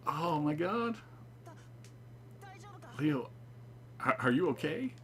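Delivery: a hint of worried Leo; lightly voiced worried Leo. Player voicing Leo checking on Saburo, worried.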